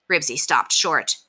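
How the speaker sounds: usual speaking voice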